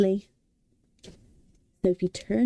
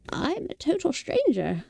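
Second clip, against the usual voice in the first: firm lie; 9 semitones higher than usual. A narrator's reading. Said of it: "Sophie lied firmly".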